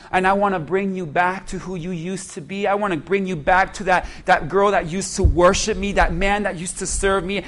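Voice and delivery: soft, hushed pleading as God; tender voice of God. Acted. Preacher voicing God softly to the congregation, tender. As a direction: speak gently, calling them home. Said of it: "'Cause God says".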